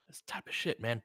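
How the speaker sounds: annoyed whispered aside; frustrated whisper. Real, not acted. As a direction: hiss it under the breath in annoyance.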